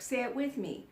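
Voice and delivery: ordinary delivery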